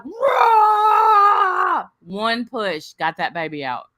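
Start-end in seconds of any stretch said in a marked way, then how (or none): 0.0-1.9 long, high, loud growling roar; imitated growl. Mother pushing the baby out, imitated growling roar. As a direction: roar through an all-out push. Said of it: "Timber makes this growling sound like".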